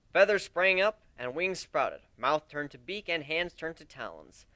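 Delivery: usual speaking voice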